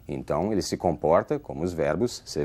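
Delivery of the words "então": ordinary talk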